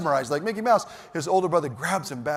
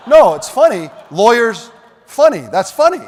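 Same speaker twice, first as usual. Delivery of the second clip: loud laughing repeats, pitched up; 6 semitones higher, 12 dB louder than usual. Real, not acted. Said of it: "That's just super funny".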